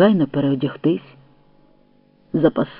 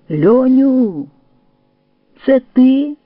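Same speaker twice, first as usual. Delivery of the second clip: high, raised questioning call; 8 semitones higher, 5 dB louder, much slower than usual. A narrator's reading. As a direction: call out to a friend you barely recognize. Calling to figure in the dark.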